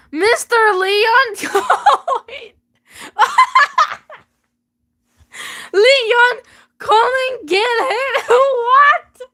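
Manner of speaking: slow, high, laughing through the name; real laughter at the name. Real, not acted. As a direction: giggle the name out slowly. Laughing over ridiculous name.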